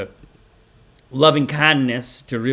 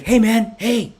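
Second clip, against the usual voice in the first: higher, louder hushing alarm; alarmed hey; 10 semitones higher, 6 dB louder, much slower than usual. Retold or imitated. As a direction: cut him off in a panic. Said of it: "and he's like".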